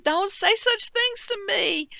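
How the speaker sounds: high, drawn-out Southern protest; hammed-up wounded plea, way up high; mock wail. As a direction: wail it in playful distress. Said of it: "It made me cry".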